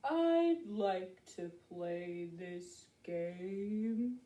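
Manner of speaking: very slow, drawn-out turtle voice; crawling storybook turtle drawl. Acted. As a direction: stretch every word like a plodding turtle. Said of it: "Slowly after Rabbit came".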